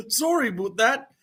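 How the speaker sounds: high-pitched mock Canadian accent. Shown as a demonstration. Streamer repeating an apology as a joke, mock Canadian accent. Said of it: "I'll do my best Canadian accent".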